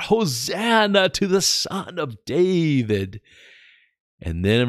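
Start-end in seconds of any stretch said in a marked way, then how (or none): 0.0-3.2 put-on high, slow, childlike cry of praise; children crying out. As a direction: call it out like a child in the temple. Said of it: "little children were crying out".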